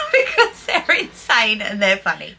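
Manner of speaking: talking through a real laugh; laughing speech. Real, not acted. Vlogger justifying a silly purchase, amused and laughing. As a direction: let the laugh run into the words. Still laughing about the sandals.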